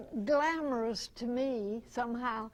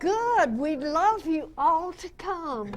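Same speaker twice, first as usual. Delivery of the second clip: much higher and louder, laughing with delight; 5 semitones higher, 7 dB louder than usual. Real, not acted. Actress invites the club to her next play, delighted and laughing. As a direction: burst out with eager joy.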